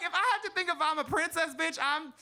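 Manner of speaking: usual speaking voice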